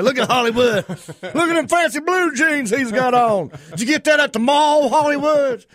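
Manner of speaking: hammed-up rural jeer, up high and loud; mocking hometown folks. Hometown folks teasing him about his fancy jeans. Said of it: "that's what they call me".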